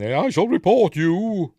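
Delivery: high, loud, slow prim threat; hammed-up offended lady; indignant threat. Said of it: "she kicks off at him again".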